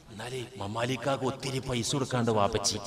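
very low, soft and quick; hushed confiding. Acted. Father secretly admitting a debt to his child, hushed. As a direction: drop to a furtive undertone.

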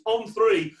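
usual speaking voice

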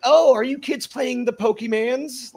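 high, slow clueless mom. Retold or imitated. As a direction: imitate a clueless mom asking. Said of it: "when your mom was just like".

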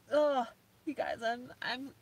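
ordinary talk, nothing marked

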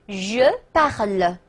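slow French, pronoun and verb separated; slow read-along model. Shown as a demonstration. Teacher leading students through a conjugation, slow read-along model. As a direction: draw out each word for repetition. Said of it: "跟我读啊".

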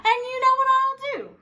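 high and fast; fierce threat. Acted. Fierce woman threatening pirate, high and fast. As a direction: rattle off the threat gleefully. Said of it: "said the fierce little woman".

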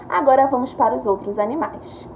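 ordinary talk, nothing marked

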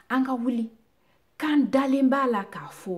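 loud, high-pitched proclamation; rallying cry. Acted. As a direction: proclaim it forcefully to the crowd. Rallying call in recited poem.

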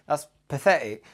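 normal talking voice

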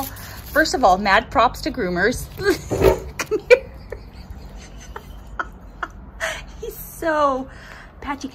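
words squeezed out between high giggles; still laughing. Real, not acted. Praising groomers while giggling at the bad haircut. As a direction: fight the giggles to speak. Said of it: "I'm laughing so hard that I have tears".

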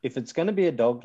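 ordinary delivery

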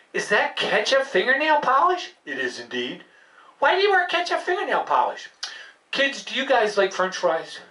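ordinary talk

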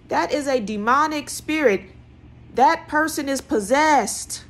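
high, loud preacher's warning; preaching at full force. Real, not acted. Preacher warning listeners about possessed partners, loud and high. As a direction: hammer the warning home from the pulpit.

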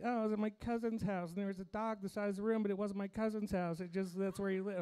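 mock breathless-with-news dream retelling; imitating wife recounting dream. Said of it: "She'll just be like".

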